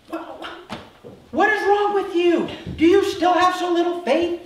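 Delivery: exasperated, high-pitched shouting; acted exasperated rebuke; frustrated scolding. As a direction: berate them in loud disbelief. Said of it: "He's frustrated with them".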